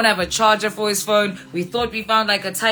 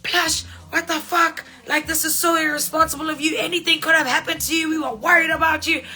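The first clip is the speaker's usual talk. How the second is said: high, exasperated scolding of a lost friend; scolding yell; 6 semitones higher than usual. Retold or imitated. Scolding Plush outside the club for vanishing, high exasperated yell. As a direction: tear into him, half laughing at the mess. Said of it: "yelling at him".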